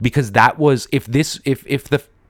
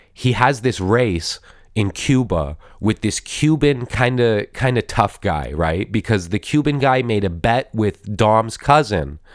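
usual speaking voice

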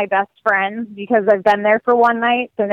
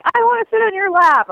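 put-on high little girl voice; lightly voiced pleading child; 10 semitones higher than usual. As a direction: ask like a small child wanting a cuddle. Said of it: "So Allie's like".

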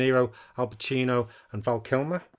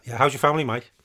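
ordinary talk, nothing marked

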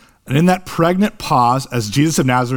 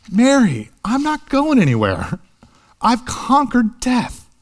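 Jesus reassuring Mary, voiced much higher; 9 semitones higher than usual. Jesus reassuring clinging Mary, higher and tender. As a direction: lift the voice high and promise she needn't cling. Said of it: "But Jesus is saying to Mary".

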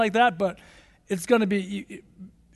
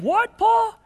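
incredulous; 9 semitones higher, 3 dB louder than usual. Pretend. Preacher mock-questions Paul's choice.

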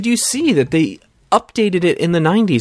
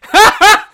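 burst of high laughter; 8 dB louder than usual. Real, not acted. Watching absurd eighties commercial.

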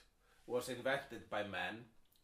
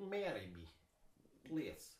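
mock Eastern European accent; 3 dB quieter than usual. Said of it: "I can't do it with some words like".

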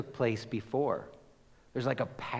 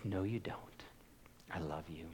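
much softer, tender reassurance; gentle voice of God; 5 semitones lower, 15 dB quieter, faster than usual. Acted. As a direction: say it softly like a loving father. God answering "I stink.

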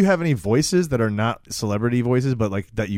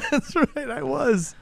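laughing; 14 semitones higher, much slower than usual. Real, not acted. Laughing reply.

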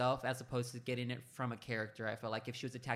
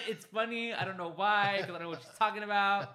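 higher, louder, words broken by laughter; laughing; 5 semitones higher, 5 dB louder than usual. Real, not acted. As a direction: laugh through your own confusion. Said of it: "But I'm laughing".

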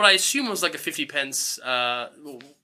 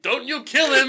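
high-pitched crowd heckler; mock protective cry; 7 semitones higher than usual. One man warns the mob off the boy, mock alarm. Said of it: "this one fucking guy is like".

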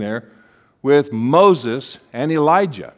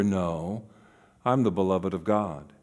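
low, soft, steady answer; quiet self-reminder; 7 semitones lower, 7 dB quieter than usual. Acted. Jesus reminding himself who he is, quiet and low. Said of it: "he would have to remember".

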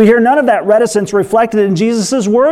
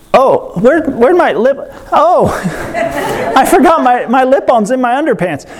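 put-on higher, giggly panic of a little boy; alarmed kid; 6 semitones higher than usual. Preacher imitating the boy finding lip balm in his underpants: higher, laughing. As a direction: squeak it out, laughing as the kid realizes. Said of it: "all of a sudden he's like".

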